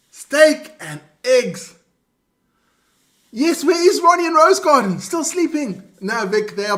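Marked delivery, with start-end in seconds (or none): 0.1-1.7 slow, savoured winner's reveal; drawn-out proud declaration; grand announcement. Pretend. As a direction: announce the winning breakfast with relish.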